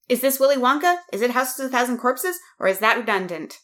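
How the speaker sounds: ordinary talk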